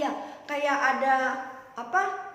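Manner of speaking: plain reading voice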